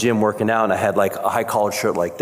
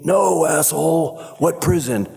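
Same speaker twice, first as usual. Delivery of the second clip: angry cop; 8 semitones higher than usual. Retold or imitated. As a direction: snap at him like an angry cop. Cop yelling at him.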